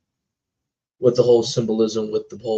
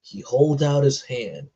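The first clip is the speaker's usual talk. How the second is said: ordinary talk, nothing marked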